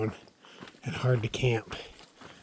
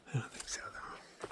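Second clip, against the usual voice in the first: soft whispered doubt; 16 dB quieter than usual. Real, not acted. Hiker whispering about the incoming storm clouds. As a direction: murmur it under your breath.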